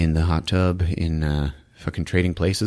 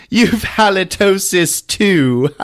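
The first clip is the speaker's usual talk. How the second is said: mock retort; 9 semitones higher, 4 dB louder, slower than usual. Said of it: "And he's like".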